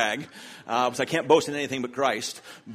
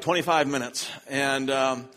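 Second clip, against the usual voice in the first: usual speaking voice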